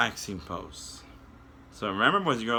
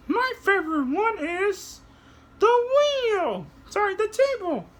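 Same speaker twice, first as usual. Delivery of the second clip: very high, slow, louder puppet flying; 4 dB louder than usual. Acted. Puppet shows kids how to fly, very high voice. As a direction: soar up into a tiny high voice.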